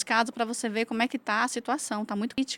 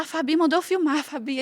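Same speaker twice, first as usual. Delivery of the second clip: flustered mother; 6 semitones higher, 7 dB louder than usual. Retold or imitated. Panicked mother quoted to police.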